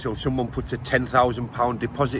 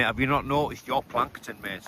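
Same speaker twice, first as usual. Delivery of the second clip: usual speaking voice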